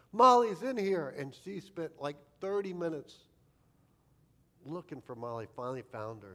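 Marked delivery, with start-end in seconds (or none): 0.1-1.1 louder and higher, a retold sister finding the cat; mock alarmed discovery. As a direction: call it out as the big sister who just found the cat. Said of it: "She's like".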